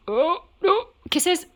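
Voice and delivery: high cooing pet voice; talking to a dog. Real, not acted. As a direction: coo for a kiss, high and loud. Asking the dog for kisses.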